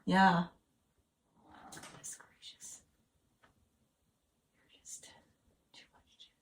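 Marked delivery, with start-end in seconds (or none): 1.7-6.3 slow exasperated whisper. Real, not acted. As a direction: breathe it out softly.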